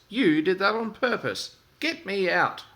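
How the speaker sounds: sooty engine angrily accusing his friend. Acted. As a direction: blame him outright. Thomas accuses Percy angrily.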